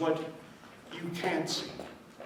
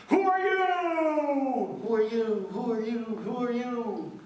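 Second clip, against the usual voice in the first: shouted question echoing off peaks; echoing call; 8 semitones higher, 3 dB louder than usual. Acted. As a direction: call out and let it echo away. Prince calls, echo repeats.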